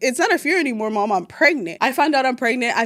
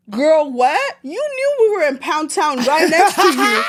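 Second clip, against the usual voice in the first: put-on higher, louder, a shouted indignant "Girl, what?"; outraged retort; 4 semitones higher, 4 dB louder than usual. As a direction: call her out for the hypocrisy. Outraged retort to mother-in-law.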